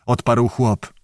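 plain reading voice

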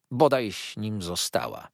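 ordinary delivery

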